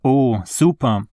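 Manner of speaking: ordinary delivery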